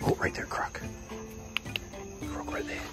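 hushed whisper near the croc. Real, not acted. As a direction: whisper so the animal stays calm. Whispering near sleeping crocodile.

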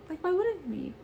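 light, welcoming family voice; imitating the family.

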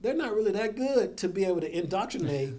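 mock the very idea with loud, high-pitched exaggeration; sarcastic. Real, not acted. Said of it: "I say that sarcastically".

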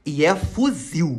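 low, slow, drawn out; ominous addition. Retold or imitated. Friend adding detail about the armed man, ominous low voice. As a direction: stretch out the dreadful detail in a low voice. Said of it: "E o colega continuou".

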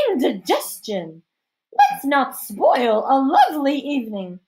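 high, loud, slow society lady; hammed-up matronly disapproval, drawn out; scandalized hostess. A narrator's reading. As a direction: recoil with theatrical disdain.

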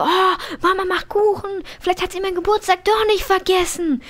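put-on high, hopeful child voice; high childlike sim voice, full of hope; voicing Raidman hopefully. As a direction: play the sim kid hoping for a birthday. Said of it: "Raidman so".

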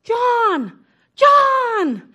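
loud, high calling out a name; calling out over a crowd; yelling from a balcony. Retold or imitated. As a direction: shout down to the street below. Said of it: "I start yelling".

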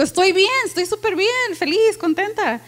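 high, bubbly and delighted; cheerful. Shown as a demonstration. Preacher showing tone of voice, high happy gushing. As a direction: gush about how happy you are. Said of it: "el tono de voz".

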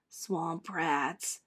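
a touch of a bully's hissed taunt. A narrator's reading. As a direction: spit the insult under your breath. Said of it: "hissed Stewie".

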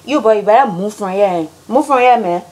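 Liberian English, pushing; Liberian accent. Shown as a demonstration. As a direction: shoo him off in Liberian accent. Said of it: "how Liberians say things".

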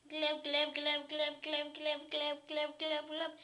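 low, soft glub glub gurgle; gurgling fish man croak. Acted. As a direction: gurgle like drinking underwater. Gurgling while drinking.

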